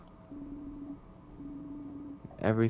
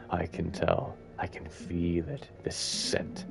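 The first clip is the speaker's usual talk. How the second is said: low, slow, softer menacing murmur; sinister king; 5 semitones lower, 3 dB quieter, slower than usual. Acted. The king sniffs Siffrin out, quietly menacing. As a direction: savour each word like a predator.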